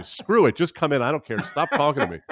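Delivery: high, loud, fed-up staff voice; exasperated giving in. Retold or imitated. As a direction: throw up your hands and let her in.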